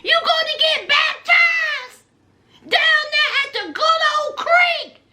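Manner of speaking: high mocking call about the creek baptism; high teasing call. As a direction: tease him in a high sing-song voice. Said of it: "I was like".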